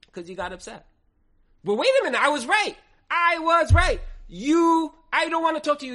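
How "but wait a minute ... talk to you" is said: high, loud, self-righteous protest; a prophet's angry insistence, pitched up and loud; indignant outburst. Retold or imitated. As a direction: insist loudly you were right. Elisha voiced justifying his anger.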